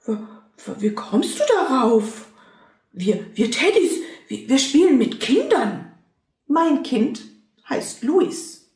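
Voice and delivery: put-on a flustered teddy defending his child; stammering other teddy.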